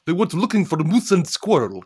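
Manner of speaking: cartoon Russian villain, lightly voiced; Russian accent. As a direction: Boris Badenov Russian accent. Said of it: "things I never do impressions of".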